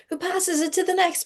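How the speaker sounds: repeated line jumps way up, playful. Shown as a demonstration. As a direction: lift it high like a game building. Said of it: "who passes it to the next person".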